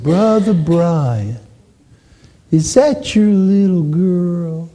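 put-on high, very slow elderly preacher voice; John Jackson impression. Old evangelist greeting a father about his child, slow high drawl. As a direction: draw each word out long and high. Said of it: "That's just the way he talked".

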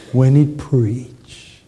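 put-on very slow, drawn-out old evangelist; John Jackson impression. Preacher showing how an old evangelist talked, slow drawl. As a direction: stretch the words like an aged preacher. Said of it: "he talked like this".

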